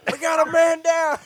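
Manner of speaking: high, loud soldier callout; man down shout. Pretend. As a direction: shout it like a battlefield medic. Mock military callout.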